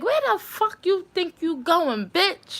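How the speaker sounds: high-pitched imitation of a menacing man; mock an aggressive confrontation; retold angry challenge. Retold threat from man in car.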